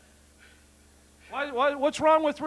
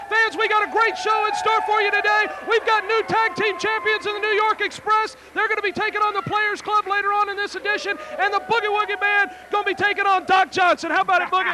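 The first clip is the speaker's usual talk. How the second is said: TV wrestling announcer; 9 semitones higher than usual. Acted. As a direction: hype up the show for the fans. Opens wrestling show as announcer.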